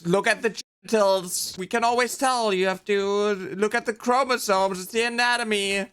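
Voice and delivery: high-pitched imitation of panicking parents; mock freaking-out parents. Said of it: "parents freaking out, being like".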